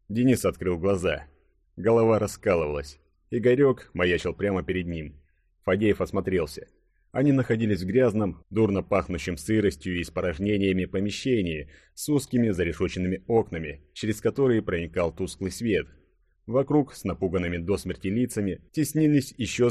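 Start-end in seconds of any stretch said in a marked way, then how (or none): none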